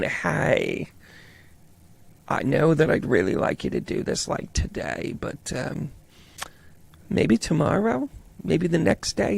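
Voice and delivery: very soft, trailing procrastinator; imagine putting it off, nearly under your breath; hesitant, sitting back. Acted. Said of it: "this is you kinda, like, sitting back there".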